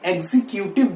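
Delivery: 'एग्जीक्यूटिव' as eg-zi-kyu-tiv; wrong pronunciation. Shown as a demonstration. Teacher quoting the common mistake, eg-zi-kyu-tiv. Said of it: "But that is wrong".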